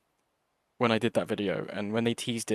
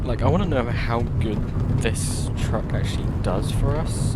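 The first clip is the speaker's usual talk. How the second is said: talking loud over road noise; speaking loudly; 6 dB louder than usual. Real, not acted. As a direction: push the voice over rumbling gravel. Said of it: "I'm trying to speak as loud as I can".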